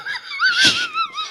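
shrieking high laugh at the prank; prank reveal laughing. Real, not acted. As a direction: cackle at catching them out.

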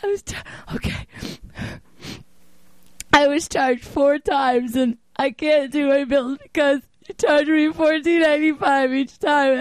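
high-pitched, drawn-out sobbing plea; hammed-up tearful wail; fake crying. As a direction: pretend to cry down the phone, choking out the words. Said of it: "I'm gonna pretend I'm crying".

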